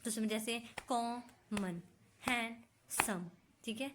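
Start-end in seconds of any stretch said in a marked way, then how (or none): 0.9-3.3 कॉमन as "कॉ... मन", हैंडसम as "हैंड... सम"; slow demo with a clear gap inside कॉमन and हैंडसम; breath broken between syllables. Shown as a demonstration. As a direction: break each word into two slow, separate beats. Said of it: "बोलते हुए word पे आपकी सांस टूट रही है".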